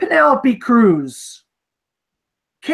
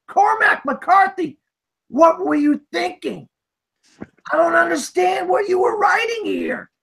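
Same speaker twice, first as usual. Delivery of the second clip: higher, shouted reproach at the writer; mock scolding shout; 7 semitones higher than usual. Host yelling at the screenwriter, Cormac McCarthy, in a high, mock scolding shout. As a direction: call out the writer like he's in the room.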